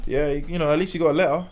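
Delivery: hammed-up mum defending her son; imitating defensive mum. Mum excusing son's grade to relatives, defensive. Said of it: "She's gonna go tell her, her aunties".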